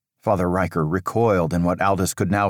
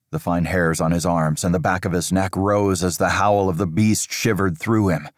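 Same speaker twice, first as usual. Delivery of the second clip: plain reading voice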